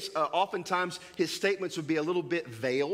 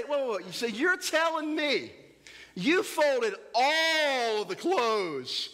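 much higher, drawn-out disbelief; hammed-up dad, slow and disbelieving; mock incredulous parent; 7 semitones higher, much slower than usual. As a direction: stretch it out like a parent who caught the lie. Parent catching child lying.

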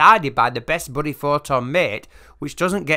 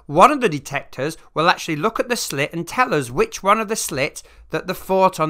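ordinary talk, nothing marked

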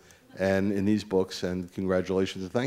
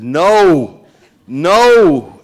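loud, high, drawn-out cry of NO; reliving a full-voiced yell of protest; yelled outcry; 10 semitones higher, 15 dB louder than usual. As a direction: yell it in anguished refusal, re-enacting your outburst. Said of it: "and I yelled".

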